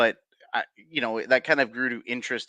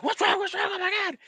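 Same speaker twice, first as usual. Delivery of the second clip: much higher, frantic repeated questioning; panicked parents; faster than usual. Retold or imitated. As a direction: mimic frantic parents bursting in. Parents bust in again.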